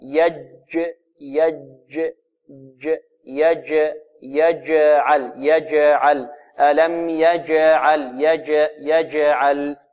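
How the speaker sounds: very slow يجعل, ج held shut then released; drawn-out drill of يج and يجعل; stopped bouncing jeem. Shown as a demonstration. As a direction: press tongue to palate on ج, then pop it. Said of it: "وسط اللسان مع وسط الحنك الأعلى".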